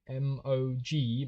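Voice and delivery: slow, letter by letter; each letter spaced and deliberate; slowly spelled out. Shown as a demonstration. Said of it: "I'm typing it M O G".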